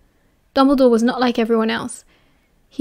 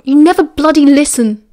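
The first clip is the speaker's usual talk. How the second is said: louder, higher angry rant; 4 semitones higher, 7 dB louder than usual. Acted. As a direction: vent at him. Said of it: "Remus ranted".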